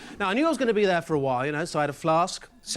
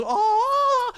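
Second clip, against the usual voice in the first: surprised oh; 5 dB louder than usual. Retold or imitated. Surprised at astronaut.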